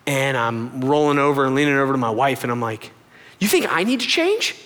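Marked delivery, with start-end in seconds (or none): 3.4-4.5 high, disbelieving question; hammed-up doubt, pitch lifted; mock incredulity. Leaning over to wife in bed, incredulous.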